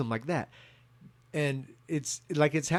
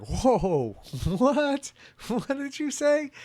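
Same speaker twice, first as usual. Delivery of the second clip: surprised; 11 semitones higher, 4 dB louder, much slower than usual. Real, not acted. Dad shocked by daughter's claim.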